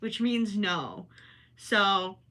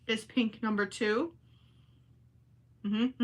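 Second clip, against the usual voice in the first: ordinary delivery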